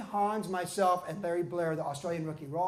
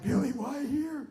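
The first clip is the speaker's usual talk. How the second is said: dismayed; 6 semitones higher, slower than usual. Retold or imitated. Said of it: "I'm like".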